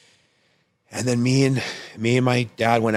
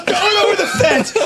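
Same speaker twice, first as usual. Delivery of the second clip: much higher, laughing through the quote; laughing imitation; 8 dB louder than usual. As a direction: squeal the quote while cracking up. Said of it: "he goes".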